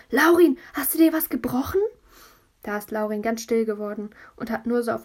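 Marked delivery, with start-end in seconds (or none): 0.1-1.8 high loud panicked shout; frightened shout. Acted. Big brother shouting to his fallen brother in alarm. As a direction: yell down in alarm. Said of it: "hat geschrien".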